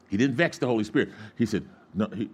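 normal talking voice

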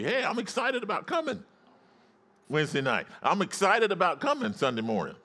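high-pitched, eager call-out; excited shout back. Shown as a demonstration. Pastor modeling the congregation's reply, excited shout back. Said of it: "need to shout back and say".

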